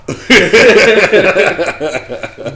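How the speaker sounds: big burst of loud laughter. Real, not acted. Host laughing hard at cohost's blunt offer, loud. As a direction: crack up out loud.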